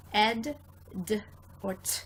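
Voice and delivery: ed, d and t said apart as three sounds; three ending sounds. Shown as a demonstration. Teacher sounding out the three past tense endings. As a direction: sound out ed, d, t one by one. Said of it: "it can make three different sounds".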